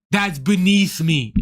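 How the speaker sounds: slow, low, mock-haughty voice; imitating the headliner. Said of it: "he's like".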